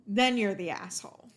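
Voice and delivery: normal talking voice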